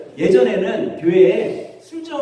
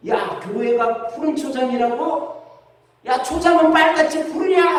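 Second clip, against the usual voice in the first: high, hammed-up drunk's complaint; heckling drunkard; 9 semitones higher than usual. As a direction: imitate a drunk picking a quarrel, rising into a shout. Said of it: "그때 이분이 뭐라고 하는지 아세요?".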